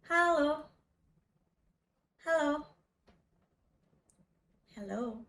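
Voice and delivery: ordinary talk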